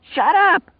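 very high, loud scream; furious shriek. A narrator's reading. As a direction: scream to silence the madman. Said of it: "Lloyd screamed".

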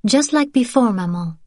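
put-on high child's voice, keen to share; a small girl pleased with the plan; eager little girl. A narrator's reading. Daughter telling her mother, high eager child voice. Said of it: "Sophie said".

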